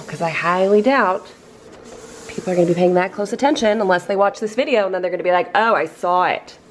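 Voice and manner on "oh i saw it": ordinary talk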